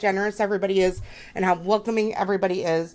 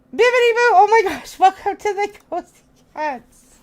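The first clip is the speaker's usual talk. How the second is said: much higher and louder excited greeting; excited welcome; 9 semitones higher, 6 dB louder than usual. Real, not acted. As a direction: squeal a welcome to the new member. Welcoming new member excitedly.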